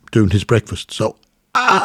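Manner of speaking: usual speaking voice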